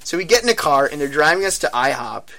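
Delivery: usual speaking voice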